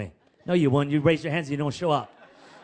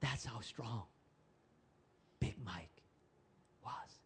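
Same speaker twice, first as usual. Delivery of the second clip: low breathless whisper, much softer; hushed whisper; 7 semitones lower, 15 dB quieter, slower than usual. Acted. Preacher hushes the congregation at the story's climax, whispering. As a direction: drop to a whisper to let it land.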